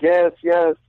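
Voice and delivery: lightly voiced Russian girl, high; high girly voice. Russian stripper quoted.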